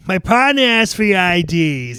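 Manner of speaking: put-on loud, heavy Boston accent; hard Boston accent. Movie cop at the cash room, hard Boston accent. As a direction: lean hard on the Boston vowels. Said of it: "the Boston accent really hard in that part".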